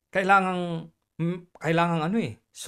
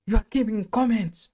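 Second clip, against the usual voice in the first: louder, higher mock manager's outburst; half-shouted scolding, pitched up; imitated shouting; 4 semitones higher, 5 dB louder than usual. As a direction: retell him yelling the accusation at you. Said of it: "nagsisigawan na ako".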